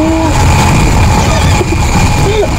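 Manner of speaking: loud, high burst of laughter. Real, not acted. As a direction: laugh out loud mid-chase. Laughs while chasing enemy.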